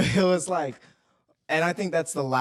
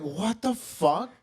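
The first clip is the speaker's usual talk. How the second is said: drawn-out shock; 8 semitones higher, much slower than usual. Retold or imitated. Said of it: "we're like".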